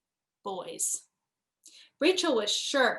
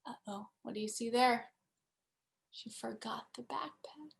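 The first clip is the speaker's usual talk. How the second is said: whispered aside; 9 dB quieter than usual. A narrator's reading. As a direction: whisper it softly like a secret. Narrator's aside to child listener.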